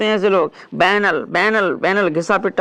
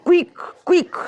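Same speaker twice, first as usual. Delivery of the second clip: squeaky quick; 10 semitones higher than usual. Retold or imitated. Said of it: "quick succession".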